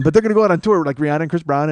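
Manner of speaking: ordinary talk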